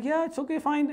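high, light, casual okay; breezy retold agreement. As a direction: agree lightly, as an unbothered parent. Parents' retold easygoing reply.

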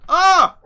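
sudden high, very loud yelp of fright; real jump-scare shriek; startled scream. Real, not acted. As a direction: scream as if something jumps out at you. Startled by a dog in the game.

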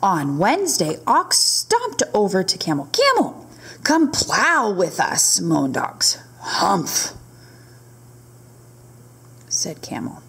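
lively storybook narration; reading to children. A narrator's reading. Teacher reading a camel story to her class, lively storybook read. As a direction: read it aloud to the little ones. Reads picture book aloud.

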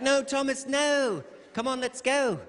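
high, sweet nanny with menace; cheerful calm over dark threats. Retold or imitated. Super nanny leading Thomas away.

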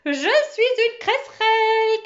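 voix aiguë, forte, syllabes étirées; crie-le à pleine voix, avec exaltation; cri exalté. Acted. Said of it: "criait-elle". (proclamation exaltée et criée)

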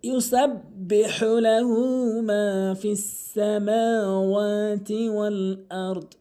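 slow, drawn-out melodic chanting; Quran recitation. Shown as a demonstration. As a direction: recite the verse in slow tajwid.

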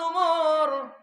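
high, very loud, long-drawn chanted cry; loud calling. Acted. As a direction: call the name out at full force, holding it long. মেয়েটি ওমরকে ডাকছে. (লম্বা টানে চড়া গলায় ডাক)

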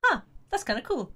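ordinary talk

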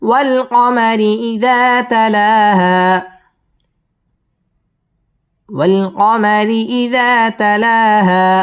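slow, high with lengthened alif; slow model recitation. Shown as a demonstration. Teacher repeating the verse after tajweed rules, slow chant. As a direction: stretch the long vowels. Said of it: "এক আলিফ টেনে পড়তে হবে".

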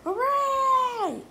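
put-on long high cheer from a crowd of kids. A narrator's reading. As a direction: cheer loud and high like happy children. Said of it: "cried the children".

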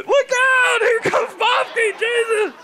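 high, alarmed mock screams; imitated screaming crowd. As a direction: shriek warnings like panicked spectators. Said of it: "everybody is screaming".

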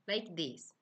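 usual speaking voice

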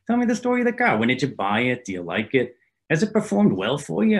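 hammed-up insistent New Yorker; Jimmy's voice. Jimmy pressing questions on phone.

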